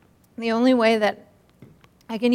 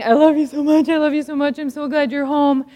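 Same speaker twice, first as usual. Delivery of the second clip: normal talking voice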